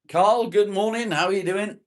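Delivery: very high, loud hail, rising at the end; calling out greeting. Real, not acted. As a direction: sing it out to a friend walking in. Hailing a viewer joining the stream.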